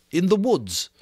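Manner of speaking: lightly voiced schoolboy explaining; Piggy interpreting to the assembly; boy Piggy, earnest. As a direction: speak for the frightened child.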